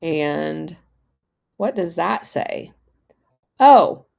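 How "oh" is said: sudden loud oh of discovery; surprised. Real, not acted. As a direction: blurt it out, caught off guard. Reacting to search result.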